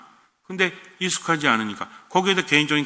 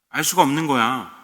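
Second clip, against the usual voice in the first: usual speaking voice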